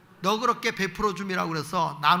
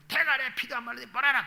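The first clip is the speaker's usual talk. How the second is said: father scolding; 4 semitones higher than usual. Retold or imitated. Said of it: "아버지가".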